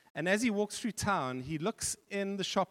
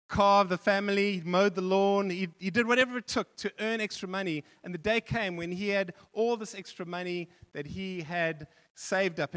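normal talking voice

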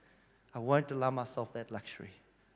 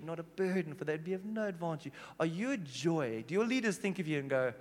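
normal talking voice

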